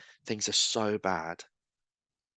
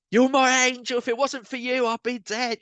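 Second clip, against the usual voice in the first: much higher retold gushing; imitating the grateful woman; 13 semitones higher, 5 dB louder than usual. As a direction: gush her thanks in a high voice. Woman tells everyone he saved her.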